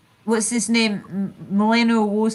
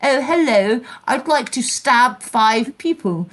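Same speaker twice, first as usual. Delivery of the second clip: mock Queen's English accent, slow and drawn out; 2 semitones higher than usual. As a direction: put on the Queen's posh accent. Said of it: "coming out with the Queen's accent".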